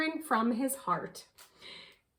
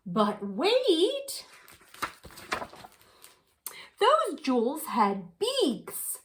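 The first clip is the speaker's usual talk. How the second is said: surprised gasp; 6 semitones higher, much slower than usual. A narrator's reading. Gasp of discovery.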